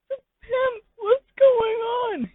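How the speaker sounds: very high, slow wailing complaint; high slow crying whine. Acted. The listener moping alone in a slow high wail. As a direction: whimper it out slowly.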